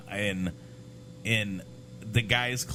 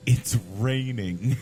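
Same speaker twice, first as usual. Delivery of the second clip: deadpan; slower than usual. Retold or imitated. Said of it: "we're like".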